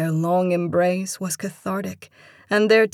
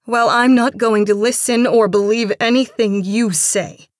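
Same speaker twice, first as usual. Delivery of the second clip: loud, higher heated retort; angry refusal; 4 semitones higher, 9 dB louder than usual. A narrator's reading. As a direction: shut them down angrily.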